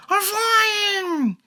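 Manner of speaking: very high thrilled cry; joyful yell. A narrator's reading. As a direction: shout it in delight. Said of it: "yells Shishi".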